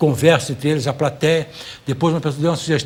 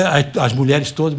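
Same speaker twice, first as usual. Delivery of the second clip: ordinary talk, nothing marked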